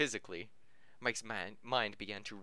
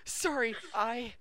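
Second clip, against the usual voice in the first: high flustered squeak; 13 semitones higher, 4 dB louder, slower than usual. Acted. Flustered Mike quote, high.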